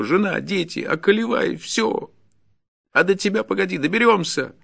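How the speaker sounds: very high, loud tirade; frantic peasant railing, high throughout; desperate fury. Acted. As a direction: cry out like a man with nothing to lose.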